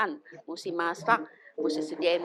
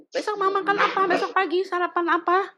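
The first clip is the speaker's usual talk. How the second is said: voicing the friend; 11 semitones higher than usual. Friend voiced in retold chat.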